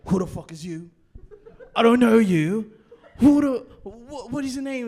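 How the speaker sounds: loud mock challenge at the door, half laughing; loud laughing imitation of an angry dealer; hostile doorman. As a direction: bark it like a suspicious dealer, laughing through it. Suspicious dealer confronts strangers at door.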